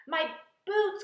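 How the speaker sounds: high, loud dismayed cry; cried out. A narrator's reading. Centipede crying out over his ruined boots, high and loud. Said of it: "cried the centipede".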